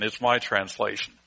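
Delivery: usual speaking voice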